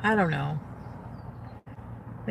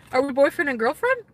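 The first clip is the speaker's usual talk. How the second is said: put-on quoting boyfriend; 5 semitones higher than usual. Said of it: "he's like".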